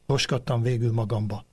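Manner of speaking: ordinary delivery, nothing marked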